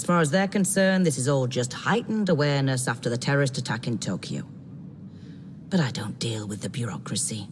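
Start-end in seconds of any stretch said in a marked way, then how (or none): 5.7-7.5 hushed, confiding whisper; whispered aside. Acted. Detective confiding in the new recruit, whispering. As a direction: lean in and whisper it like a secret.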